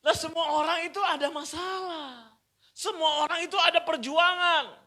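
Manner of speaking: high, loud preached point; preaching at full force. Acted. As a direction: drive the point home. Preaching emphasis.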